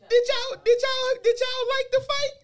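high teasing uncle voice; imitating the uncle asking if they liked the fight; uncle's question. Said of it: "He was like".

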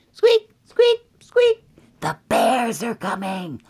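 very high, loud little squeaks; squeaking mice. Acted. Mice warn Goldilocks the bears are coming, high squeaking. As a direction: squeak a warning as tiny mice. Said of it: "the mice hear that the bears are coming".

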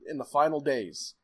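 ordinary delivery

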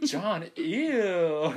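high, loud laughing "ew"; mock disgust. Retelling a friend's reaction, laughing mock disgust. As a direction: squeal the friend's grossed-out reply with a laugh. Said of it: "she was like".

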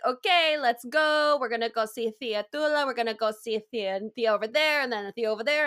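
hammed-up dad announcing the family outing; imitating dad. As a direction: round everyone up like an eager dad. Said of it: "my dad's like".

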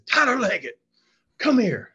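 high, loud call across the room, retold; coach's summoning shout. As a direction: act out calling a player over sternly.

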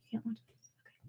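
whispered aside to listeners. Real, not acted. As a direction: hush it as a secret.

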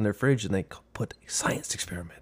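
unvoiced, secretive whisper. Real, not acted. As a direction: hush it like a shared secret. Whispered punchline of story.

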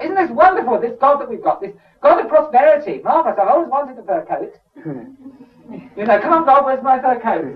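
high, loud gushing mockery; mock greedy believer. Preacher mocking a woman craving a fur coat, high gushing. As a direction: gush over wanting a fur coat. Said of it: "kind of thing".

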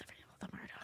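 hushed conspiratorial whisper. Real, not acted. Co-host whispering a jab at the Murdoch press, hushed. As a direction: breathe it like a secret. Muttering aside about tabloids.

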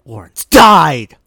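loud, slow dramatic cry; slow loud Lawrence died; dramatic exclamation. Real, not acted. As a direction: cry it out slowly. Guessing dramatically.

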